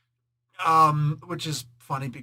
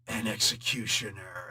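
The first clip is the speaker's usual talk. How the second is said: avenger impression; 7 semitones lower than usual. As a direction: drop the pitch to pass sentence. Imitating Ghost Rider's judgement line.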